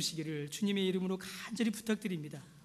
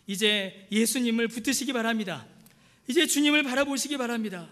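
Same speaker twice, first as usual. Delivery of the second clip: higher, louder closing exhortation; climactic preaching; 5 semitones higher, 5 dB louder than usual. Acted. Pastor urging the congregation at the close, higher and louder. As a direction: build to a fervent climax.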